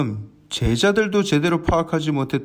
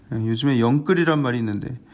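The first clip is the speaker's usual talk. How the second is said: ordinary delivery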